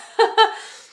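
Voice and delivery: a quick embarrassed laugh; brief high giggle; short laugh. Real, not acted. Embarrassed about candy in mouth.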